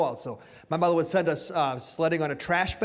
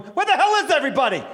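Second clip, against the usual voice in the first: higher, louder, quick puzzled outburst; student alone in an empty school, baffled and calling out; confused student; 6 semitones higher, 5 dB louder, faster than usual. Retold or imitated. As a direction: call out to an empty hallway. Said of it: "anyone at Biddeford High School going".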